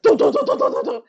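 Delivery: loud chanted dramatic music, rising high; chanted dun dun. Retold or imitated. GM voicing the player being dragged, loud chanted tune. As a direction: belt out a mock suspense theme. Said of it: "and you're like".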